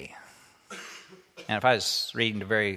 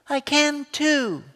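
put-on high, slow, whiny little girl's insistence; little girl protesting; 4 dB louder, slower than usual. Rachel protesting to her brother, high and slow little girl voice. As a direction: stretch it out like a stubborn small girl.

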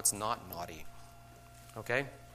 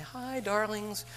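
high, syrupy greeting that slows on darlings, then a fast breezy rush; pasting on a cheerful voice while panicking inside; sugary put-on teacher voice hiding panic; fake-sweet teacher; 7 semitones higher, faster than usual. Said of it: "But I'm gonna come back to you and say".

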